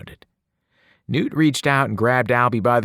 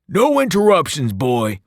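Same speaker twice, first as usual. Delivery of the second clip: much higher, louder barked order; angry shout; 8 semitones higher, 6 dB louder than usual. Acted. Albie shouts at the new boy to stop interrupting, furious. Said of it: "Albie shouted".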